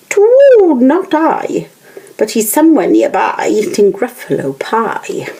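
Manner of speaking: put-on an owl telling the child off; hooting owl. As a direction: hoot it out like a haughty owl. Owl answers.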